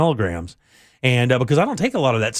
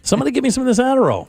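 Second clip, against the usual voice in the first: eager begging; 8 semitones higher, faster than usual. Pretend. Host begging his co-hosts for Adderall, fast eager plea. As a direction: beg for it like you can't wait.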